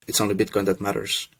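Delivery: ordinary delivery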